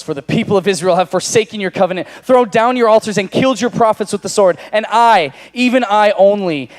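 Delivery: rising loud, high protest; bitter complaint. Acted. Elijah's complaint repeated.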